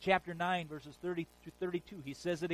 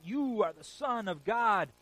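loud and slow, a bit higher; demons crying out; 5 semitones higher, 7 dB louder, slower than usual. Retold or imitated. Demons crying out to Jesus, slow loud cry. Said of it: "the demons cry out".

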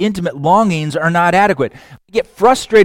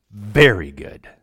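solemn pronouncement; 8 semitones lower than usual. Acted. God's final pronouncement.

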